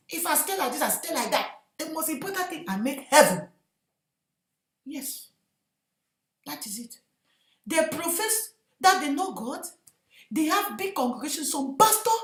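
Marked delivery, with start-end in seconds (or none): none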